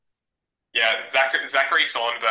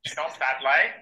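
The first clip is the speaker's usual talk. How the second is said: a rowdy, broad Aussie bloke challenging someone; a laughing South Adelaide tough guy; broad Australian accent; 4 semitones higher than usual. Shown as a demonstration. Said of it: "Australian accents are very hard".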